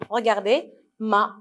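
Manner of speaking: ordinary delivery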